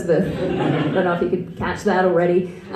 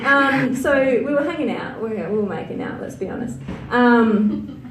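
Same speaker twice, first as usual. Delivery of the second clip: ordinary talk, nothing marked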